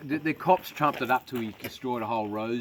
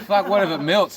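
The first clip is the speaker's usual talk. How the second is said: mock shocked shout; 8 semitones higher, 12 dB louder than usual. Retold joke, shocked comeback.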